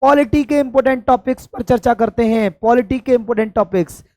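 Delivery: usual speaking voice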